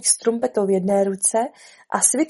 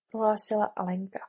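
plain reading voice

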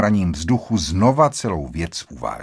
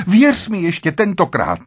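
high, urgent cry of entreaty; desperate pleading; 8 semitones higher, 4 dB louder than usual. A narrator's reading. Wife begs husband to trust her, desperate pleading. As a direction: implore him with raised pitch.

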